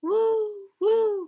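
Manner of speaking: rising ghostly woo of the wind; wind howl. A narrator's reading. Wind sound in story.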